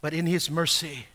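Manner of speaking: normal talking voice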